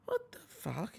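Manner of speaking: soft, high disbelief; stunned. Retold or imitated. Host reacting to the fight, softly stunned. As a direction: breathe it out in shock. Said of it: "And I'm like".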